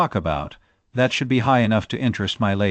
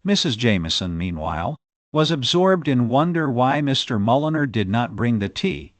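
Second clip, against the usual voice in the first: ordinary delivery, nothing marked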